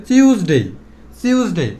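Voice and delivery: টিউসডে starting with an s-like sound, "siuz-dei"; alternative pronunciation. Shown as a demonstration. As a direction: swap the t of টিউসডে for a hissing s. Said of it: "আরও একটা নাম আছে". (টিউসডে-কে "সিউসডেই" বলা)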